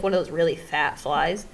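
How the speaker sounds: ordinary delivery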